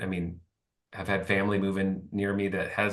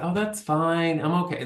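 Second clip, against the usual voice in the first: higher, mock-cheerful reassurance; a light imitation of his old self; pleaser voice; 7 semitones higher than usual. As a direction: brush it off brightly to keep the peace. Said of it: "normally I would have said".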